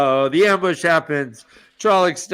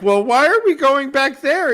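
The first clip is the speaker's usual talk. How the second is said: lightly voiced higher, puzzled officer; questioning officer; 10 semitones higher, 3 dB louder than usual. A hint of the captain questioning his general. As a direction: ask it with a touch of naive doubt. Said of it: "he's like".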